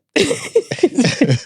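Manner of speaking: squealing burst of laughter; sharp high laugh. Real, not acted. Laughing at nabii remark.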